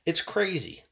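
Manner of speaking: normal talking voice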